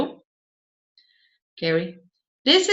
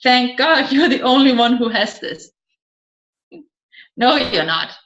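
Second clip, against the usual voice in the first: normal talking voice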